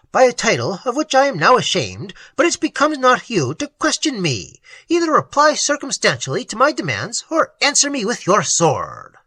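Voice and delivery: a hint of a haughty challenge; indignant young nobleman.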